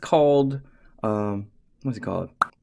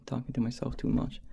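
quick whisper to himself; whispered aside; 7 dB quieter, faster than usual. Real, not acted. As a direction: mutter it under the breath. Muttered aside.

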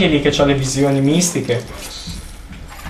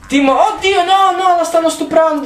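high-pitched, panicky outcry; alarmed; 12 semitones higher than usual. Real, not acted. Player seeing the girl attacked in the game, alarmed. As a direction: cry out in sudden high alarm.

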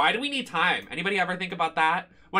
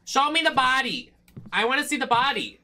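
eager demand; 9 semitones higher, 4 dB louder than usual. Real, not acted. Eager to open the box.

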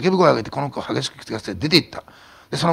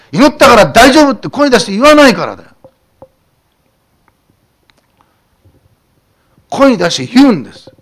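much louder and higher, shouting the message; fiery preaching; 8 semitones higher, 14 dB louder than usual. Acted. Pastor rebuking silent believers, shouting sermon. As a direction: hammer it home from the pulpit.

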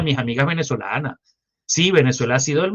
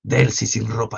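ordinary talk

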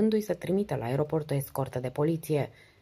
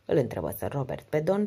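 plain reading voice

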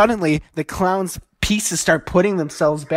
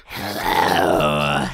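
low, drawn-out creepy clown greeting; 12 semitones lower than usual. Acted. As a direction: leer through the hole as the clown. Clown voice through the hole.

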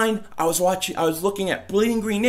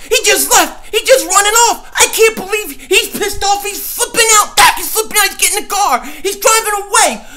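very high, loud frantic yelling; mock panicked shouting; 8 semitones higher, 6 dB louder than usual. Host yelling as Dak drives away, frantic shouting.

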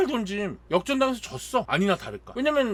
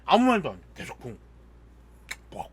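usual speaking voice

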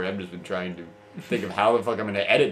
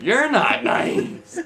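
mock childish voice; 11 semitones higher, 7 dB louder, much slower than usual. Childish retort voice.

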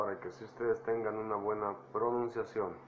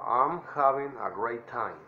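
drawn out once more for copying; slow teacher demo; slow model phrase. Shown as a demonstration. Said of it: "traten de imitar mi voz".

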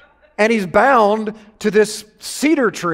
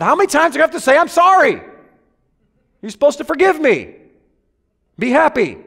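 higher and louder, hammed-up exasperation; exasperated to wife; 6 semitones higher, 4 dB louder than usual. Husband to his wife in an argument, hammed-up exasperated whine. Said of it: "I told my wife that".

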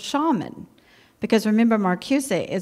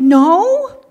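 blunt no; 8 semitones higher, 11 dB louder than usual. Retold or imitated. Flat refusal to husband, retold.

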